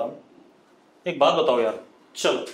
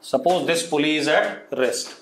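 slow dictation; much slower than usual. Shown as a demonstration. Dictating statement twice.

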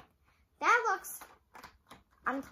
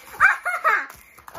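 high giggle; 10 semitones higher, 9 dB louder than usual. Real, not acted. Laughs at the chocolate pile.

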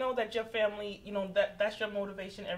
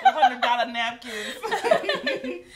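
slow, louder laughing joke; 8 dB louder, much slower than usual. Real, not acted. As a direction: drag it out laughing. Joking about pricey napkins.

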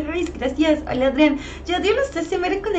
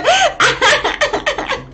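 high squealing laugh; high burst of laughter; 10 semitones higher than usual. Real, not acted. Cracking up at the nickname in chat, high squealing laugh. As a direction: let a high giggle burst out.

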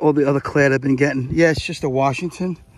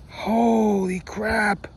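a long, drawn-out, higher holy crap; awed exclamation; 7 semitones higher, much slower than usual. Real, not acted. Finding a rare coin in the dirt, slow and high with amazement. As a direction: stretch it out in stunned delight.

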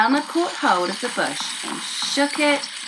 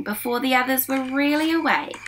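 ordinary delivery, nothing marked

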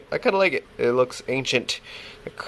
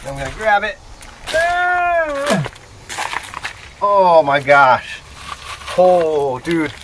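higher, louder thrilled exclamations; excited; 6 semitones higher, 6 dB louder than usual. Real, not acted. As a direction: gasp with delight at the find. Finds calcite crystal in rock.

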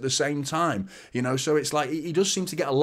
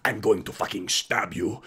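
put-on lower, menacing Russian accent; 5 semitones lower than usual. Football pundit showing how a threat terrifies, Russian accent. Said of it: "in a Russian accent".

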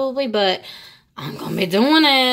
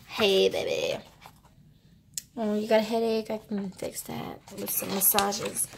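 mock flirting; 8 dB quieter than usual. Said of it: "I'm being weird".

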